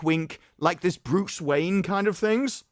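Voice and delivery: ordinary delivery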